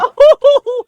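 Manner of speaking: high, loud whooping laugh; loud burst of laughter. Real, not acted. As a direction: let a big laugh burst out. Reacts to own joke.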